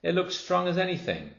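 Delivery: put-on children reassuring the girl; confident children. A narrator's reading. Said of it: "the others said".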